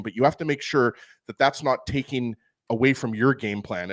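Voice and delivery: normal talking voice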